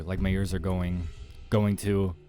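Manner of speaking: usual speaking voice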